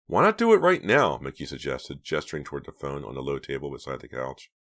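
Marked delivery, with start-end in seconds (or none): 0.1-1.2 high, loud and eager; daring them on. A narrator's reading. Eager suggestion.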